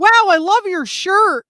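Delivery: high, loud put-on admiration; imitated gushing stranger. Stranger complimenting her band shirt, imitated gushing.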